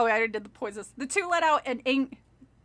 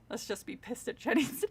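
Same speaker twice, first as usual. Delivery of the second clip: plain reading voice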